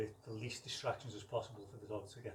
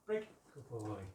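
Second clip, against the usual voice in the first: ordinary talk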